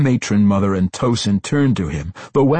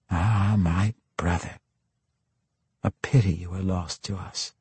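soft mourning; 5 dB quieter than usual. A narrator's reading. Mourning her dead brother.

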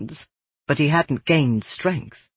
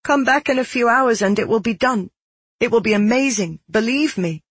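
higher-pitched, hurried reassurance; placating; 7 semitones higher than usual. A narrator's reading. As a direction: plead to be believed, pitch raised. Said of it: "I tried to placate him one last time".

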